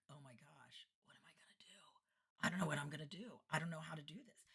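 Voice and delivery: hushed, panicky inner voice; quiet panic. Retold or imitated. Entertainer to herself as panic sets in, hushed. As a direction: fret under your breath, retelling the panic. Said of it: "then immediately the panic sets in".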